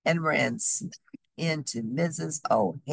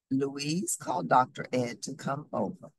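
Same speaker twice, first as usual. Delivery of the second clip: ordinary delivery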